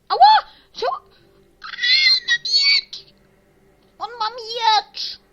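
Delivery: very high, drawn-out shriek; panicked scream. Real, not acted. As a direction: scream in alarm at full volume, stretching the words. Attacked by player with a sword.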